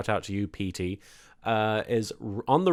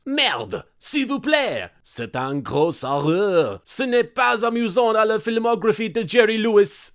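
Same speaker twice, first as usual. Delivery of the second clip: high, loud hammed-up French accent; over-the-top comic French accent scream; 9 semitones higher, 4 dB louder than usual. A narrator's reading. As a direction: shriek it like a fleeing Parisian in a thick French accent. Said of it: "scream in their amusing accents".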